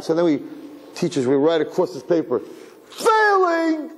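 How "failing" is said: sudden high, louder exclaim of Failing; hammed-up scold in a high pitch; mock teacher's cry. Teacher mocking a failed test, with a high exaggerated cry.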